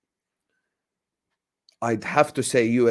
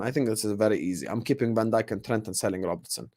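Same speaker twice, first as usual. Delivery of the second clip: normal talking voice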